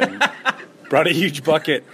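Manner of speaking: laughing through the story; amused telling. Real, not acted. Player recounts his chicken prank to interviewer, amused.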